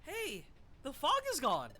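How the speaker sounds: high, thrilled exclamation; amazed high cry. Acted. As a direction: call out in wonder. Amazed at sunlight.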